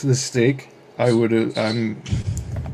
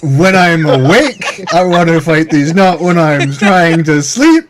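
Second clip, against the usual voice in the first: loud protest; 4 semitones higher, 7 dB louder, slower than usual. Acted. Defensive in-character retort.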